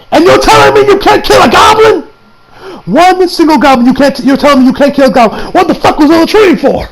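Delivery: high, loud incredulous rant; outraged disbelief. Acted. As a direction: demand an answer, pitch shooting up. Acted outraged disbelief at characters.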